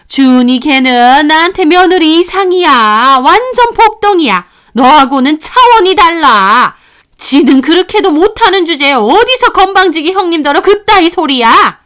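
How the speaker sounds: loud, high, indignant tirade; angry scolding. Acted. Mother-in-law praising the other daughter-in-law over this one, angry shouting.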